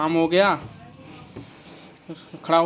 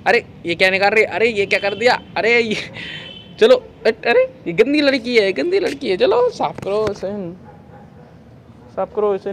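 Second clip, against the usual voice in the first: higher, louder mock scolding; 6 semitones higher, 5 dB louder than usual. Real, not acted. Baby-directed scolding play. (गंदी लड़की, लाड़ भरी डाँट)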